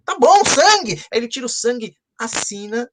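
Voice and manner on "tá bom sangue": much higher and louder, eager; eager agreement. Acted. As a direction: jump to agree with a high, loud voice. Man agrees to sign in blood.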